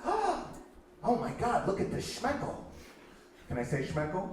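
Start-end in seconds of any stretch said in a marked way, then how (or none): 0.0-2.6 put-on high, astonished Yiddish-accented gasp. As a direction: marvel slowly in a hammed-up Yiddish accent. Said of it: "forgive my terrible Yiddish accent".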